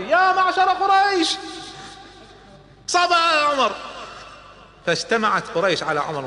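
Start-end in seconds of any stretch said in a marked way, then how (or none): 0.0-1.4 high, slow shout across the square; a town crier's loud call. Acted. Jamil calls out to Quraysh. 2.9-3.7 high ringing cry of the news; shouting it to everyone; loud announcement. Acted. Jamil announces Omar converted.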